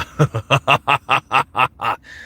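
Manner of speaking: long staccato cackle; character laugh. Acted. Voice actor laughing as his favorite character, staccato cackle. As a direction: laugh in separate, punched bursts. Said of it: "I love voicing Bob".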